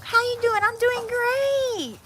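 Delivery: high, slow, overly bright fake cheer; fake cheerful voice. Shown as a demonstration. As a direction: pretend everything is fine, sing-song high. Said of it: "Make a stranger think ain't nothing wrong".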